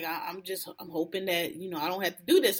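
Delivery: mock a timid, reluctant warning; lightly voiced, soft hesitant warning; timid plea. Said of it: "She was like".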